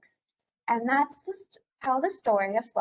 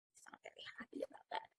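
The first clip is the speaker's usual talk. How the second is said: whispered aside; 21 dB quieter, much faster than usual. Real, not acted. Reader whispering an aside to the listening children. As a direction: lean in and whisper it.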